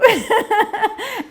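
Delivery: high, loud burst of laughter; real amused cackle; hearty laugh. Real, not acted. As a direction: laugh out loud at your own story. Laughs at own story.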